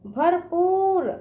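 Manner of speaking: भरपूर with पूर held long; drawn-out long ū. Shown as a demonstration. Teacher repeating for children, भरपूर with drawn-out ū. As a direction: stretch the ū in भरपूर. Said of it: "इसका उच्चारण दूर तक जाएगा".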